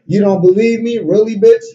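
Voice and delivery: high, loud mocking challenge; acted yell. Nancy scoffs loudly at Gloria's disbelief. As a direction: throw it at her loudly. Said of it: "Nancy yelled".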